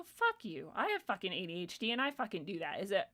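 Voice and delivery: normal talking voice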